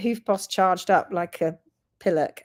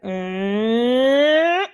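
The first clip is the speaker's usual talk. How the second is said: rising motor hum; 4 dB louder than usual. Retold or imitated. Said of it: "and then you go".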